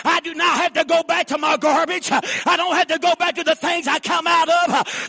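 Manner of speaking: high, loud sermon shout; preaching at full force. Acted. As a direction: lift the congregation up.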